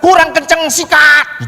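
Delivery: urging shout. Retold or imitated. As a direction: yell at the driver to floor it. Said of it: "Kalau saya peran".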